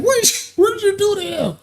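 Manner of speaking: squeaky retold disbelief; shocked girlfriend. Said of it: "she like".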